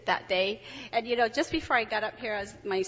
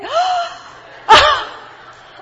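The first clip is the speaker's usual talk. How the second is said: high gasp into a laugh; 16 dB louder than usual. Real, not acted. Gasping laugh.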